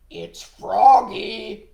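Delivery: slow, loud croak at the door; croaking frog. Acted. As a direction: announce yourself as a big frog. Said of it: "croaked the familiar voice".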